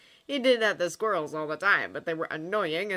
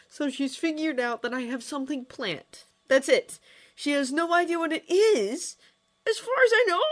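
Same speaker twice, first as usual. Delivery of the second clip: raised, high character voice; animated, fretful dialogue; Hiccup worried; 10 semitones higher, 7 dB louder than usual. A narrator's reading. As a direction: reason it out anxiously, pitch climbing.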